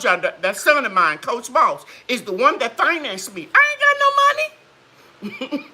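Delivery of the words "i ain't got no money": high squeaky mock complaint; sounding like Randy. Telling her live chat she's broke, in a high squeaky mock whine. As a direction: pitch it way up like a broke cousin. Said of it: "Sounded like Randy".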